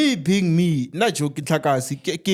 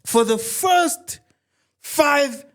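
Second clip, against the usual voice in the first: hyped salesman pitch; 3 semitones higher, slower than usual. Retold or imitated. Salesman slashes price dramatically.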